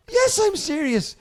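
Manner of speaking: high, loud, slow protest; hammed-up earnest protest; indignant insistence. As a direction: insist on it, drawn out. Acted insistence while being laughed at.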